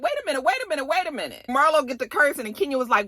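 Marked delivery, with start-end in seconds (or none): 0.0-1.5 high, rushed wait-a-minute; alarmed protest. Retold or imitated. Guests stop Marlo's rant with a high alarmed wait. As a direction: cut her off in alarm, imitated. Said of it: "they was like".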